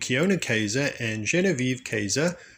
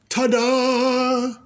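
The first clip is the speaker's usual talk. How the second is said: higher, louder showman flourish; magician's ta-da; 13 semitones higher, 6 dB louder than usual. A narrator's reading. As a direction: reveal the trick with a flourish.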